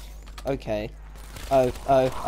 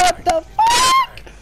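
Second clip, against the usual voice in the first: high, loud, drawn-out shout; startled shout; 6 dB louder, much slower than usual. Real, not acted. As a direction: yell in sudden shock. Sudden attack in game.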